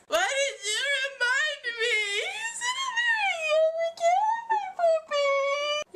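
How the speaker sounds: fake-sob over the poor puppy, milking it; hammed-up crying. Mock grief over her pet.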